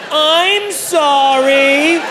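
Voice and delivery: high, long-held wail of apology; mock yell. Comic recapping his outburst to the doctor, wailed mock yell. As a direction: stretch the apology out on high notes. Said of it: "he's had to deal with".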